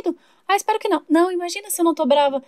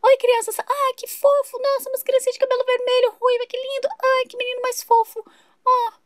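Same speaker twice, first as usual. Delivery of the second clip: put-on a bit higher and slower, cooing over kids; gushing neighbour; 6 semitones higher than usual. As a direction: coo at the children like a doting neighbour. Vizinha encantada com as crianças. (vizinha derretida pelas crianças)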